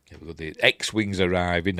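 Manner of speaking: ordinary talk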